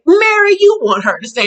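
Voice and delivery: exaggerated insistent name-call; mock pleading. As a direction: call the name high, as if begging. Imitating Heather's repeated plea.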